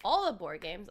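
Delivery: normal talking voice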